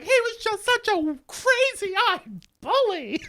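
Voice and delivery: hammed-up high voice, slowly accusing; mock complaining victim. Angela telling the house about Matt, mock distraught. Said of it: "And then she's like".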